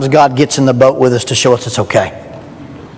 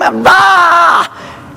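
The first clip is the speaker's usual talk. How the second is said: a high, sudden yelp of fright; hammed-up terrified shriek; short high scream of panic; mock fearful scream; 13 semitones higher than usual. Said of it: "And so they scream".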